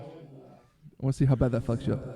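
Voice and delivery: ordinary talk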